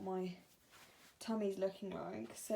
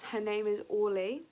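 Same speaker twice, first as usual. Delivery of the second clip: normal talking voice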